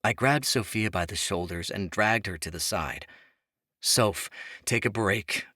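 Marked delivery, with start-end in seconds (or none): none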